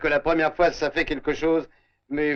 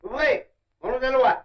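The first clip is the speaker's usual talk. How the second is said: official call; 7 semitones higher than usual. Acted. Police at the door.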